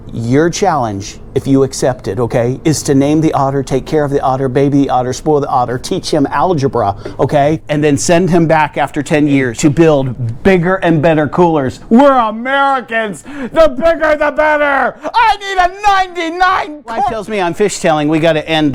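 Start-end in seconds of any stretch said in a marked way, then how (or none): 0.1-11.8 low, grave spy-mission voice; brief them like a secret agent; mock mission briefing. 11.9-16.8 mock patriotic shout. Patriotic rallying cry about coolers.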